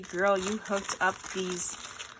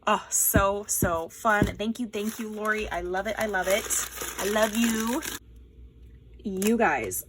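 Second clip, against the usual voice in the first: ordinary delivery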